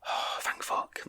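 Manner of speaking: breathy whispered relief under the breath; relieved whisper. Retold or imitated. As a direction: whisper it like a secret sigh of relief. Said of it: "moment where they go".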